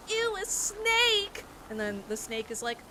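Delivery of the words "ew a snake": high, slow squeal of disgust; grossed-out kids. Retold or imitated. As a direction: stretch it out like the group shrieking at a snake. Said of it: "everybody's like".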